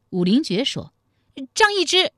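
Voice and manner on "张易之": high, loud blurted name; flustered captive. Acted. Forced to name who took it. (高声急急吐出名字)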